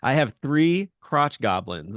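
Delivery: slow deadpan quip; deadpan mom. Retold or imitated. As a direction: drop it slowly, totally unbothered. Mom's deadpan reply in retold bit.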